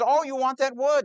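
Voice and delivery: mock surprise. Supplier taunts builder on phone.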